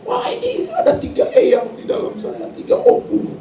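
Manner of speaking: very high put-on squeal; imitated possessed cries. Possessed youth announcing three spirits inside, imitated high cries. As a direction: mimic the small youth's high-pitched cries. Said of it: "Kecil begitu teriak".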